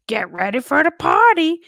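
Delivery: high, loud hammed-up party call; mock hype from the ride's voodoo lady; Mama Odie impression. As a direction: shout it like a host revving up a crowd. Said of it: "Mama Odie's like".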